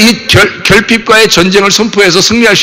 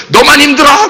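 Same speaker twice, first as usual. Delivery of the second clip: mock quarrel, raised pitch; 싸우는 말투; 7 semitones higher than usual. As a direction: throw it back like an acted argument. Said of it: "이제 뭐 싸움이 얘기죠". (말다툼 흉내 내며 높게)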